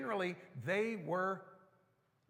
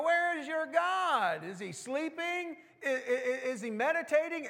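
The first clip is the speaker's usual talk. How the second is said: talking smack; 9 semitones higher, 5 dB louder than usual. Retold or imitated. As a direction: needle them, savouring every jab. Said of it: "He was talking smack to these people".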